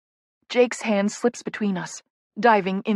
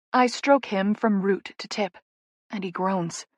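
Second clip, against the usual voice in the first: usual speaking voice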